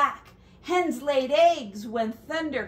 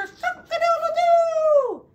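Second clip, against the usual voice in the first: high, loud crowing; rooster crow; 8 dB louder than usual. Acted. Rooster crowing back to normal.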